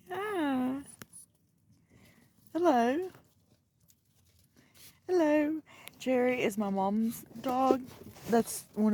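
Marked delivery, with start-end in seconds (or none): 0.1-5.6 higher sing-song hello; high greeting. Real, not acted. Saying hello to the dogs, sing-song high greeting. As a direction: call a bright hello to the dog.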